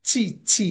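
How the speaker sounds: "চি" with a full "ch" from mid-tongue on palate; firm palatal "ch" in each "চি"; thick chi sound. Shown as a demonstration. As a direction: repeat "চি" with a thick "ch", not "s". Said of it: "সাউন্ডটা অনেক মোটা একটা sound".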